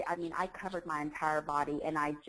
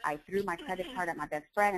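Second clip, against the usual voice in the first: normal talking voice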